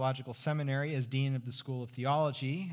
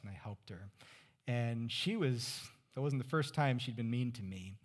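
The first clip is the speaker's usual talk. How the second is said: ordinary talk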